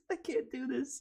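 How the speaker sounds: laughing through the words. Real, not acted. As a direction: giggle while giving up. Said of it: "I sound like a dying animal".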